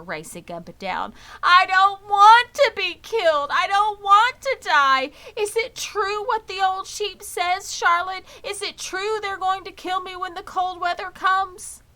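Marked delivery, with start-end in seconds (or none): 1.4-11.8 high, loud frantic pleading; panicked pleading. Acted. Wilbur begs Charlotte for the truth, high and loud in panic.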